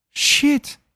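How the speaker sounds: high, sharp blurted curse; sudden curse. Acted. Lena realising she is late, shocked outburst. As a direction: blurt it out as it suddenly dawns on you. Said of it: "zegt ze ineens hardop".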